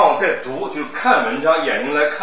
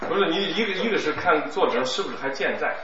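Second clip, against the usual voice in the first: ordinary talk, nothing marked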